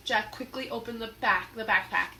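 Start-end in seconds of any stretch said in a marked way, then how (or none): none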